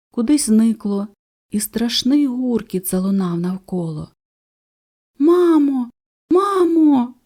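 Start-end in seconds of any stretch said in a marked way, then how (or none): 5.2-7.1 high, loud scared call; frightened chicks. A narrator's reading. Chicks calling mother as the storm breaks, scared and high. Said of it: "заметушилися пташенята".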